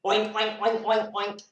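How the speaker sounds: quick repeated pig oinks. Pretend. Reader showing a pig's sound, oinking. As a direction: oink briskly like a pig. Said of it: "A pig".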